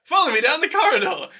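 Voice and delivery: retold host. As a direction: imitate an eccentric guide leading the group on. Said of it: "And he's like".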